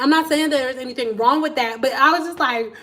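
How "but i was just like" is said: usual speaking voice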